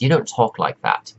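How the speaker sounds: normal talking voice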